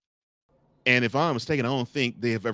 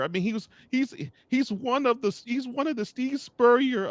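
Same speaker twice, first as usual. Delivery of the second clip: high, excited fan voice, pitched way up; mock giddy fan; 12 semitones higher than usual. Host imitating a co-host dreaming of Gator glory, high and giddy. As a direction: gush like a hopeful Gators fan. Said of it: "Playmaker was thinking".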